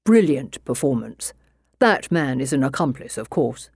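a composed, skeptical English detective; inspector voice. Acted.

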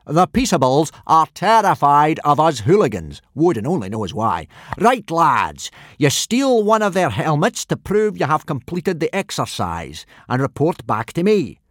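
big blustering Viking teacher; booming Gobber. A narrator's reading. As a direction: boom the orders at the boys. Gobber voiced in story.

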